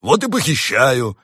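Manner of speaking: loud, slow, high bear roar; stubborn bellowing bear; growling bear. A narrator's reading. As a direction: roar it back defiantly as the bear. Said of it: "прорычал в ответ медведь".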